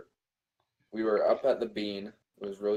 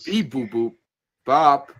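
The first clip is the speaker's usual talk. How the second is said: robot beeps; 3 semitones higher, 7 dB louder, slower than usual. Pretend. SpongeBob meme beeps.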